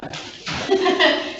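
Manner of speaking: short awkward laugh; nervous little laugh; embarrassed laugh. Real, not acted. Embarrassed over microphone trouble.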